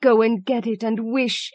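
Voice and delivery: high, urgent cry, quivering with excitement; wife urging her husband quivering with excitement; excited cry. A narrator's reading. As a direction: order him, trembling with eagerness. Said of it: "cried his wife".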